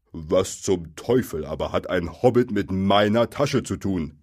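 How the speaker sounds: hint of an affronted troll; lightly voiced indignant troll. A narrator's reading. Bill asking about his pocket, mildly indignant troll.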